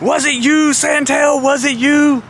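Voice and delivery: high, insistent mock accusation; Jeff Jarrett. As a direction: imitate a boss grilling a suspect. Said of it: "Jeff Jarrett comes over and he grabs me".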